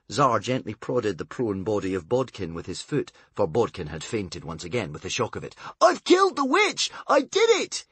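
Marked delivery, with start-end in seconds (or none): 5.8-7.9 high, loud triumphant yelling; crowing over his victory; joyful shouting. A narrator's reading. Tsar shouting at the fainted bodyguard to wake up, triumphant. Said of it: "in his joy".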